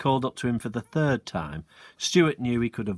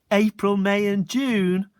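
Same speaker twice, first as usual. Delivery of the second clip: punchline; 9 semitones higher, 5 dB louder, slower than usual. Acted. Stuart's punchline.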